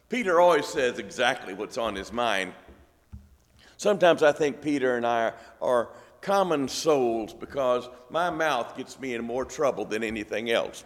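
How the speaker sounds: usual speaking voice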